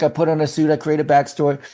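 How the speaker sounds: ordinary delivery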